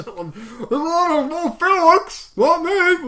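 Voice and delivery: loud, high, garbled mouth-full shout. Shown as a demonstration. Kid protesting while chewing watermelon, mouth full. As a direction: yell through a mouthful of watermelon. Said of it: "with his mouth full of watermelon".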